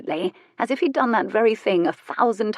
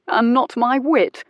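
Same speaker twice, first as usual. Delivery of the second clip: slow, higher and louder, playfully offended; mock affronted; 6 semitones higher, 5 dB louder, slower than usual. A narrator's reading. Teasing his lover, slow and louder mock offence. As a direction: stretch it out, pretending to be wounded. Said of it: "He sounded affronted".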